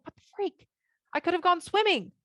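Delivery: exasperated, high-pitched replay of a thought; frustrated. Retold or imitated. Wife recalling her annoyance at her husband still in bed, frustrated. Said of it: "I feel really frustrated".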